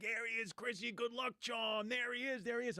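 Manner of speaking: high, sing-song mock calling of the regulars; hollering there he is at the lucky kid. Racetrack dirtbags greeting the boy, high mock hollering.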